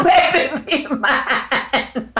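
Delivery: high-pitched words dissolving into long laughter; laughing. Real, not acted. Speaker cracking up while talking about Michael, laughing. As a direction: burst out laughing mid-phrase. Breaks into laughter mid-speech.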